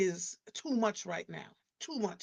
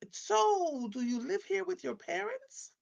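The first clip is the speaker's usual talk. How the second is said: mimicked young neighbor; 10 semitones higher, slower than usual. Neighbor's question voiced in story.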